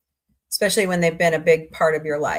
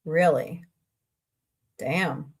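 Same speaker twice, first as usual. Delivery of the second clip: normal talking voice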